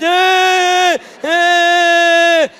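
hammed-up high sobbing; mock crying wail. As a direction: wail over the hairdryer noise, hammed-up. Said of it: "she's crying".

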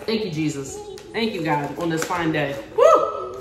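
normal talking voice

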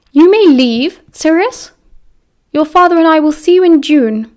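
high, loud, haughty scolding; stern, disdainful mother. A narrator's reading. Mother scolding her crying son about decorum, haughtily. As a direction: scold him from a great height.

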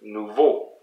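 French "nouveau", weight on "veau"; last-syllable stress. Shown as a demonstration. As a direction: demonstrate "nouveau" stressed at the end. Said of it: "Another word".

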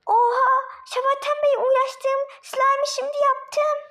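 high, loud excited exclamation; thrilled shout. Acted. Child thrilled that her slime is finally done, shouting high. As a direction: burst out with joy at the finished slime.

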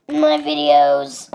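slow, loud silly voice; annoying put-on voice. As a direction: lightly put on an annoying voice, drawing it out. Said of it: "Sorry if that voice is annoying".

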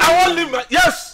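high, loud voice; heated interruption. Acted. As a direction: shout over the others. Shouting over friends in quarrel.

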